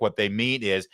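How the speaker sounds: normal talking voice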